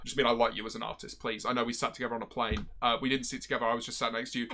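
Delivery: low, hushed hurried backtrack; sheepish backpedalling. Real, not acted. Streamer apologising to a singer after a crude remark, sheepish and hushed. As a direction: drop right down and retract it quickly. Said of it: "I'm sounding really weird".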